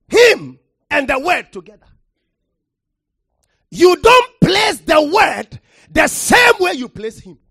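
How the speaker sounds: loud, rising pulpit delivery, hammering the word; preacher thundering from the pulpit; full-force preaching. Acted. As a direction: preach it hard to the congregation.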